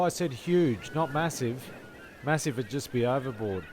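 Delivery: ordinary talk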